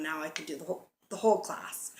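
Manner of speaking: hiccups breaking the words; suppressing hiccups. Real, not acted. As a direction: talk through hiccups. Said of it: "Sorry, I have the hiccups".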